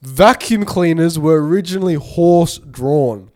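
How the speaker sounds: fact reading. Acted. Reads a fact.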